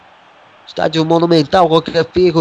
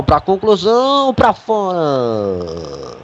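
excited miss call; much slower than usual. Real, not acted. Commentator calls a shot wide.

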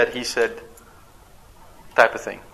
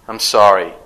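mock grudging sorry; 6 semitones lower, 7 dB louder than usual. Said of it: "there's nothing".

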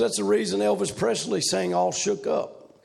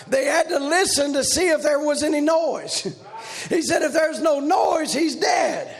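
shouted, chanting preacher's cry; preaching at full force; 6 semitones higher than usual. Acted. Preacher crying holy to the congregation, shouted chant. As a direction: ring it out like the bell.

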